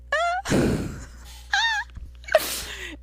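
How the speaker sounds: shrieking burst of laughter. Real, not acted. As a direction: cackle up high and let it fade. Big laugh at her noises.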